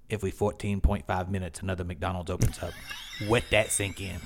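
deadpan parting fact, r's and l's turned to w's; imitated cashier. Cashier handing over receipt with final fact, imitated. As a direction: deliver it like a clerk's grand closing line. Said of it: "sticks out, he goes".

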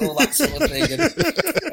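high, loud laughing through words. Real, not acted. Mocking his friend's tough talk, laughing.

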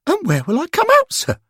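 put-on a young lad asking nervously; schoolboy Derek. A narrator's reading. Derek asking the angels about the portal, high and curious.

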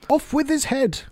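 storybook Queen's high cry; shouted order. A narrator's reading. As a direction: order an execution as a furious Queen. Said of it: "and shouting".

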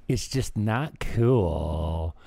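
ordinary talk

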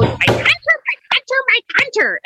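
high, insistent repeated name; mock granny outburst. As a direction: bang the table and demand it. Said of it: "smacks the dining room table and goes".

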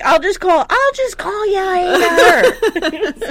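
loud, high yell breaking into laughter; shouting back. Retold or imitated. Daughter to deaf dad over blaring TV, laughing shout. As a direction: holler it high, stretching the end.

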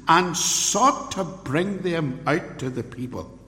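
high, slow, ringing sermon delivery; forceful preaching. Real, not acted. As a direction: drive the point home from the pulpit. Preaches on the riot.

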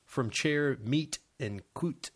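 a lightly put-on French accent, reading a definition aloud; hammed-up French accent, savouring the fancy words; terrible French accent. Host reads the charcuterie definition to his cohost in a terrible French accent. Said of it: "I'll stop with my terrible French accent".

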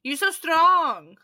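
very slow, drawn-out teasing flattery; slow mock flattery. Host teasing cohost to carry bags, slow mock flattery. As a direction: stretch it out like sweet-talking.

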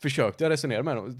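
ordinary talk

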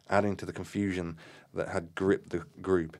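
usual speaking voice

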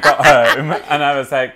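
normal talking voice

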